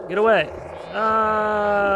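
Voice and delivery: high, loud yell into a held cry; shouted warding off. Real, not acted. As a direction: yell to drive an attacker back. Shooing off attacking game enemy.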